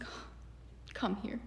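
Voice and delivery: soft whispered comforting; hushed, hammed-up relief; mock tender reunion. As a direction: whisper it, pulling someone into a hug. Acts out tender reunion in skit.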